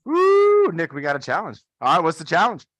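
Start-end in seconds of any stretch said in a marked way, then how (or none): 0.0-0.7 high loud woo; excited whoop. Real, not acted. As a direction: let out a big happy whoop. Whoops before the push-up challenge.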